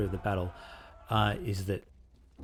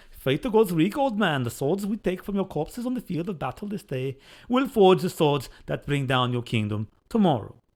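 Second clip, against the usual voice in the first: higher, louder put-on Nordic accent; dodgy Nordic accent; 8 semitones higher, 6 dB louder than usual. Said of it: "a bit more of a Nordic bastardized accent".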